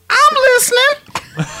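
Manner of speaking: very high, slow squeal; drawn-out high-pitched hungry cry; Hungry Dude. Acted. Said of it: "now Hungry Dude is".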